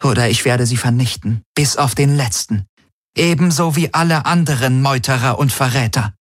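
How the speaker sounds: lightly voiced imperious woman; Ylana, cold commander. A narrator's reading. Ylana ordering Galen beside the holoscreen, cold command.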